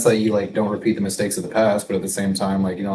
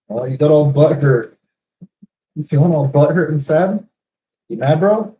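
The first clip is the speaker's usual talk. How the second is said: taunting; 7 semitones higher, 7 dB louder than usual. Pretend. Taunting an imagined hurt person.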